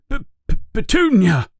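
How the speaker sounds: high, fast stammered cry; stammering yell. A narrator's reading. Vernon yelling for his wife in a stammering panic. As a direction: panic and stumble on the name. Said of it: "he yells".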